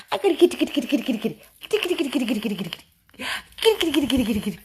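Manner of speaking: high, rapid-fire repeated syllables; squeaky tickle noises in words; playful tickling chatter. Real, not acted. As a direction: rattle it off high while tickling a pet.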